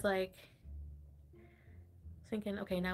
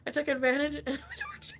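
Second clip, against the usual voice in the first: higher, hurried words broken by laughing; suppressed laughter; 4 semitones higher, faster than usual. Real, not acted. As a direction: try again through leftover giggles. Retries the sentence while laughing.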